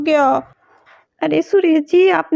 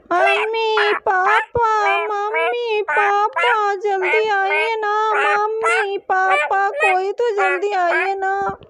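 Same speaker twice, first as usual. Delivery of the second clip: langgerekt, smekend roepen naar mama en papa; uitgerekt roepen; 3 semitones higher, much slower than usual. Acted. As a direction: rek elke naam lang uit alsof je van ver roept. Trapped kids call for parents.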